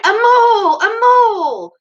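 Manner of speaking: high, loud, long-held shout; ringing war whoop; war cry. A narrator's reading. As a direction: bellow it as a battle charge. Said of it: "shouting his awful war cry".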